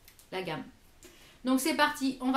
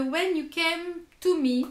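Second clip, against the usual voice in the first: English title with a real French accent; strong French accent on the English; 4 semitones higher, 4 dB louder than usual. Real, not acted. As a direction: read the English name as a non-bilingual French speaker. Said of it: "excusez mon anglais parce que je ne suis pas bilingue".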